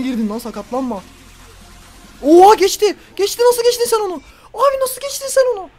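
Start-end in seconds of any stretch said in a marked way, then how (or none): 2.2-5.7 very high, loud disbelief; high-pitched excited outburst; astonished shouting. Real, not acted. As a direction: yell in amazement at a stunning move. Player beats defender, streamer amazed.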